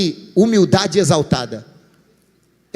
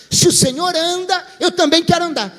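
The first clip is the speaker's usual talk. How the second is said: bold eager; 9 semitones higher than usual. Acted. Peter's daring repeated.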